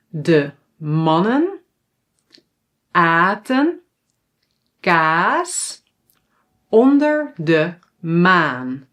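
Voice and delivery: very slow, long pauses between phrases; slow tongue twister. Shown as a demonstration. Teacher lets learners repeat, slow phrase by phrase. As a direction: break it up for repeating, long A's drawn out. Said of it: "repeat after me".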